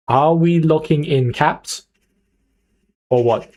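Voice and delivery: drawn-out words, pause before OR WHAT; slow, insistent question. Real, not acted. Streamer presses chat for a decision, slow and insistent. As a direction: spell it out slowly, then tack on the nudge. Asks chat again, slowly and deliberately.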